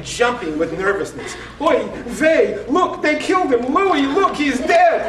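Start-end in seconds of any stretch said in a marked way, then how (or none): 1.6-5.1 high, loud panicked cries at the TV; imitating a panicked grandmother, hammed-up; frantic grandmother. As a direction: yelp it high like the wrestler really died.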